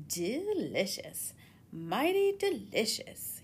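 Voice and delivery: slow, savouring reptile voice; crocodile voice. Acted. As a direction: linger over the taste like a lazy crocodile. Crocodile tastes the lollipop.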